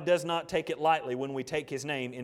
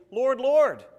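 loud, high desperate cry of Lord Lord; pleading cry; 10 semitones higher, 10 dB louder than usual. Acted. People at the judgment cry out to Jesus, loud and high. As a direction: call out as if begging at the gate.

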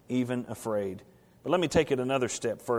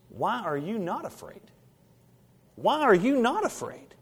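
amazed disbelief; 7 semitones higher than usual. Acted. Disciples' unasked question.

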